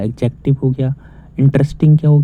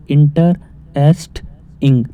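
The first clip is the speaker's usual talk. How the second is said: Interesting slowly split into three parts with pauses; slow teacher's breakdown of interesting; broken into syllables; much slower than usual. Shown as a demonstration. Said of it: "अब इसे हम तोड़ रहे हैं".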